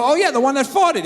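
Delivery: mock innocent reply with a laugh; high mock voice. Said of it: "And I go".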